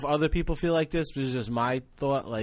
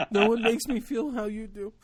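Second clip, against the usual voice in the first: high, hammed-up gushing through laughter; mock-blubber a declaration of love; overcome with love; 10 semitones higher than usual. Overcome uncle answers his niece.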